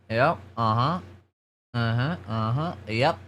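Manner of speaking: usual speaking voice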